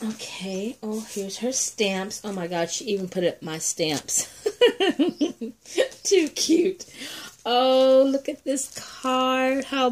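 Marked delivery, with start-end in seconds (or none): 4.3-6.0 a high burst of happy laughter; delighted laugh. Real, not acted. Opening a swap package, finding her own stamps: delighted laugh. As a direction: laugh with real surprise and joy.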